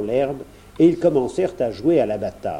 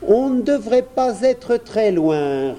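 lightly voiced boy, high pitch; little prince; 9 semitones higher, 4 dB louder than usual. A narrator's reading. Prince guides the bear to the well, lightly voiced. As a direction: remark in a high, boyish voice.

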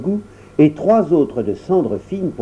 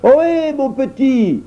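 calling out; 13 semitones higher, slower than usual. Acted. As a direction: shout up to the giant. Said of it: "cria de tous ses poumons".

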